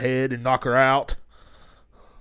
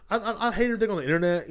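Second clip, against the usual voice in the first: mock whiny high voice; 8 semitones higher, much faster than usual. Sudden high fast voice.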